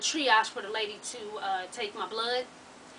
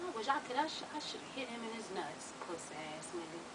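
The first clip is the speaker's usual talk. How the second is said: muttered aside; 13 dB quieter than usual. Real, not acted. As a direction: say it to yourself, still bitter.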